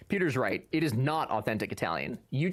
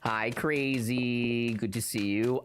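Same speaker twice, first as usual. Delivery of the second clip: slow greeting; 3 semitones lower, slower than usual. Real, not acted. Greeting a chatter.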